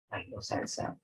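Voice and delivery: Bailó salsa softly, rising on salsa like a yes-no question; soft question, lift the end of salsa; Bailó salsa with a quiet ascending tune. Shown as a demonstration. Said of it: "cómo sería en un tono ascendente".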